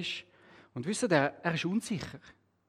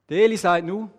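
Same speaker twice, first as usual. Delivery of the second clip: plain reading voice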